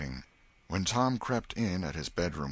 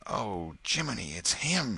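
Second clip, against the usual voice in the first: higher startled exclamation; exclaimed; 5 semitones higher than usual. A narrator's reading. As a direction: blurt it out in alarm. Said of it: "exclaimed both boys".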